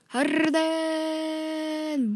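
hyped call. Real, not acted. Hyped drawn-out player call.

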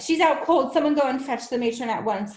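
high, loud urgent orders; urgent cry. Acted. Miss Honey calling to the children for the matron, urgent. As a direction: call for help at once. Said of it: "she cried".

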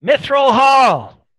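high, loud shout from above. Acted. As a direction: yell it down from the balcony. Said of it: "his sons shouts down".

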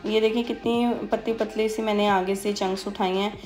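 ordinary talk

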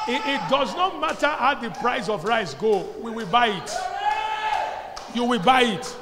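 loud, high declaration; rally the crowd with conviction; preaching shout. Acted. Pastor rallying the congregation, shouting.

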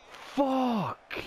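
a sudden high, loud swear that falls away; furious shout. Real, not acted. Gamer raging at a conceded goal, furious high shout. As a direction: explode at the goal on screen.

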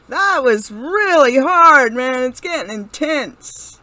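loud and breathless after the round; excited. Real, not acted. Gamer reacting to a hard round, loud and excited. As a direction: blurt it out, buzzing.

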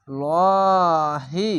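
extremely slow heavy লহি with a ringing hold; sung Arabic recitation; Quran recitation. Shown as a demonstration. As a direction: draw লহি out for several beats. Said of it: "শেষ অক্ষরে সাকিন দিয়ে দিলাম".

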